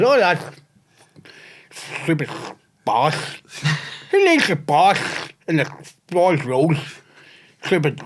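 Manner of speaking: lightly voiced cranky taxi regular; put-on taxi grumbler. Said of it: "I can't keep that voice on".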